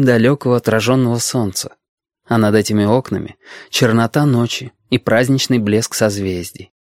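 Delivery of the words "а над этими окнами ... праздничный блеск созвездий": ordinary delivery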